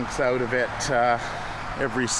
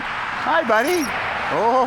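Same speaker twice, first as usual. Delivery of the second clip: very high, louder cooing greeting; pet talk; 13 semitones higher, 4 dB louder, slower than usual. Real, not acted. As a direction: squeal hello to a tiny animal. Greeting a chipmunk.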